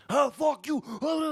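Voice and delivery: high, loud shout of fuck you; mock shout. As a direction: yell it like a brawler starting a fight. Kids hear a street fight start.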